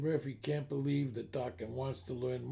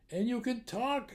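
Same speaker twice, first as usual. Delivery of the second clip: higher-pitched, lightly acted wonder; mock amazement; 7 semitones higher than usual. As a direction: marvel a little, voice raised in pitch. Publican amazed the duck talks.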